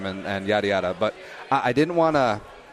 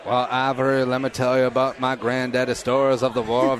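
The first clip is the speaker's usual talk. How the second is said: Southern twang; 2 semitones higher than usual. Acted. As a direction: play a Southern storyteller with full twang. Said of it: "I would go full on, like, twang".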